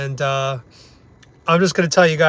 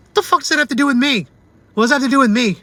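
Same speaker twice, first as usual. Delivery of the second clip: high, fast indignant outburst; outraged; 8 semitones higher, faster than usual. Real, not acted. Angry customer ranting about the vet's excuse, high and indignant. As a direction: fire the question back, incredulous.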